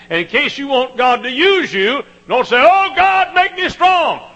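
high, loud, mocking plea; preacher caricaturing a demanding prayer; full-force preaching. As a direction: shout the wrong prayer with scorn. Preacher mocks wrong prayer.

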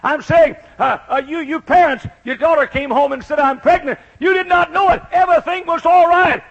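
high, loud, hammering preacher; full-force preaching. Acted. Preacher confronting parents about their pregnant daughter, full-force preaching. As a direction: drive each phrase home at full volume.

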